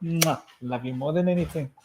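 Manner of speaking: soft tender bedtime voice; pillow talk. Real, not acted. Parent saying goodnight to child.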